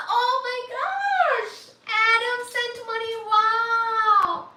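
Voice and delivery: high, drawn-out squeal of thanks; thrilled amazement. Real, not acted. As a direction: gush in high-pitched disbelief. Thrilled at a donation.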